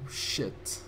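breathy whispered shit under the breath; dismayed whisper at the screen; whispered curse. Real, not acted. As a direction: let the curse slip out in a whisper. Shocked muttered curse.

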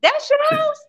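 squealing high disbelief, way up in pitch; high astonished daughter; shocked daughter. Retold or imitated. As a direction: shriek it like you can't believe your eyes. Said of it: "she was like".

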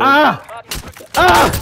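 pained yelp. Real, not acted. Hit by enemy fire in game.

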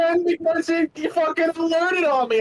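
high, strained shouting back; defensive shout. Real, not acted. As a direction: yell back in self-defense.

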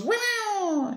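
an excited cat squeal; cartoon pet gasp of delight; cat's voice. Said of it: "And he goes".

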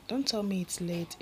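plain reading voice

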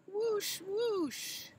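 high, breathy whooshing spray; water spray whoosh. Acted. Mama showing calf the trunk spray, whooshing high.

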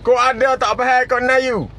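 normal talking voice

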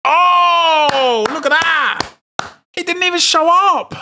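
very high and loud pack reaction; over-the-top pack-opening shock; shocked excitement. Real, not acted. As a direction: yell at the great pull. Huge pack pull, excited.

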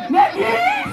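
sudden high-pitched shriek of the name. Real, not acted. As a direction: yelp it out high in alarm. Announcer cries out at the fall. (grito agudo e repentino)

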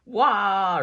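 high loud panicked wail; a big frightened cry of alarm; dinosaur wail. A narrator's reading. Rex wailing in the hallway, loud panicked wail. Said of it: "Rex wailed from the hallway".

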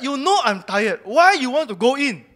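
loud, high, exasperated retort; angry complaint. Retold or imitated. Tired husband complaining loudly to his wife and mother. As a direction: snap at them for going into the shop. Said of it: "I was so angry at two of them".

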